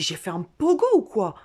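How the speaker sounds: loud confused self-question; bewildered outburst. Acted. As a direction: blurt it out, stunned. Tu te dis.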